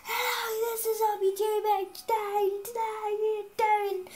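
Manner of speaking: high, sing-song hammed-up channel intro; mock her old shouty intro, half sung; mock youtuber intro. Mocking how her old intros sounded, a high sing-song shout. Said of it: "instead of me going".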